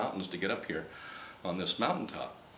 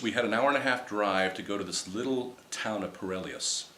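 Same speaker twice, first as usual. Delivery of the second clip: usual speaking voice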